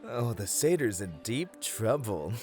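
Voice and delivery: usual speaking voice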